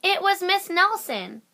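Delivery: ordinary delivery, nothing marked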